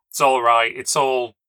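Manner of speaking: loud, agitated, breaking off unfinished; upset outburst that cuts off; about to cry. A narrator's reading. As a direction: insist loudly while close to tears. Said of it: "she broke into tears".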